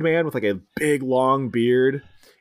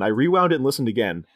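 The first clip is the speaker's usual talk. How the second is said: normal talking voice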